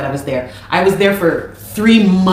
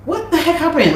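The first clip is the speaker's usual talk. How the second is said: stunned disbelief; 9 semitones higher than usual. Retold or imitated. Retelling shock at his change.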